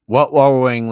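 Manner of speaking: loud. Pretend. Loud ad interruption.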